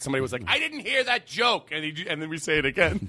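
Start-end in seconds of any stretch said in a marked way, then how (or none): 0.5-1.7 high, drawn-out heckler yell; mock angry heckler. Imagined audience member complaining he missed the joke, mock angry. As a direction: shout it slowly like an aggrieved heckler. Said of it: "What if there was a fight".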